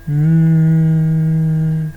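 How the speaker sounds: "N" as a long loud nnnn; "N" sounded out as a sustained hum; letter sound. Shown as a demonstration. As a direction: hum and hold "N" without a vowel. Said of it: "la lettre qui fait le son".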